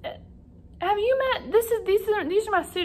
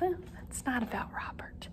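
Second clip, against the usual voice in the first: unvoiced, much softer whisper; whispered aside; 13 dB quieter than usual. Teacher whispering to the class about the book. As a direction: whisper it behind the puppet's back.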